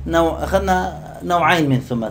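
normal talking voice

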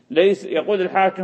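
ordinary talk, nothing marked

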